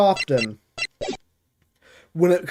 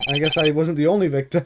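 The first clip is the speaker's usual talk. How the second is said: ordinary delivery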